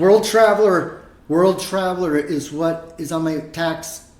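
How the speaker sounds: slow, loud proud announcement; proud title. Acted. Man boasting about his tax title, slow and proud. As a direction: savor the grand title slowly.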